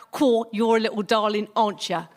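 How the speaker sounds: usual speaking voice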